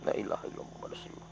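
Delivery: fast, breathless whisper of la ilaha illallah; rushed whispered prayer. Real, not acted. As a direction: rattle off the prayer in a frightened whisper. Rapid fearful prayer.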